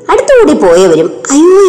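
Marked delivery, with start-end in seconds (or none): 1.3-1.7 high rising cry from onlookers; passersby cry out in alarm; rising shocked exclamation; echoed cry. A narrator's reading. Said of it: "എന്ന് ഏറ്റുപറഞ്ഞു".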